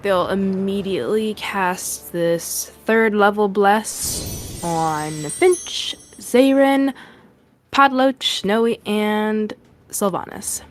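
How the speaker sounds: ordinary talk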